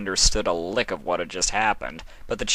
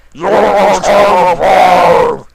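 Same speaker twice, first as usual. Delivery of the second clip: much higher, loud, slow yell; shouting; 10 semitones higher, 14 dB louder, slower than usual. A narrator's reading. Sombra yells into Nightmare Moon's squished face. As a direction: bellow it right in her face.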